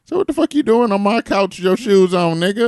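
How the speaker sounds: high, loud scolding; angry. Retold or imitated. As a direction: yell at the guest. Scolding the house guest.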